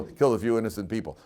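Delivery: ordinary delivery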